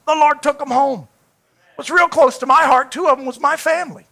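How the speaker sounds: ordinary talk, nothing marked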